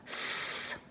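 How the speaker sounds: soft voiceless hiss, sss; hissing s sound. Shown as a demonstration. Teacher confirms the answer with a soft hiss. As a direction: let the S hiss out quietly. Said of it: "That's right".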